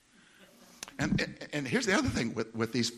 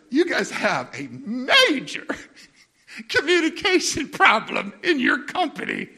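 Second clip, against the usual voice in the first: laughing complaint; 12 semitones higher, 8 dB louder, slower than usual. Retold or imitated.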